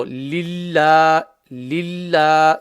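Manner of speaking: lillah drawn out slowly with a light lam; empty-mouth lam. Shown as a demonstration. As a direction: hold lillah long, keeping the mouth empty. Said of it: "will be pronounced empty mouth".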